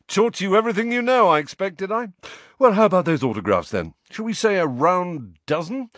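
bright, high and fast with self-importance; vain celebrity voice. Acted. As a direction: gush like a vain star. Lockhart character voice.

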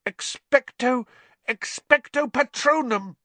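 high, loud pleading cry; desperate cry. A narrator's reading. Harry alone after Hermione collapses, desperate cry. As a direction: force the spell out alone.

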